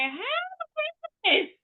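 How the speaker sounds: hammed-up alarm at the bill; panicked disbelief. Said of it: "and I'm like".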